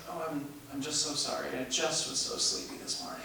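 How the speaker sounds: soft, sleepy mock Russian accent; a lightly done Russian accent, half-whispered apology. Late Russian tenor apologising to the cast, in a soft mock Russian accent. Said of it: "in his Russian accent".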